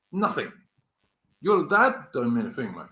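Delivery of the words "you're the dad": usual speaking voice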